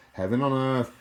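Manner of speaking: Heaven on earth burst out high and loud; excited exclamation. Shown as a demonstration. Praising the friend's car, high and loud. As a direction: cry it out in rapture.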